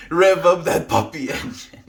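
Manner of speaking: loud, high puppy hype-up; excited call to the puppy; playful dog talk. Real, not acted.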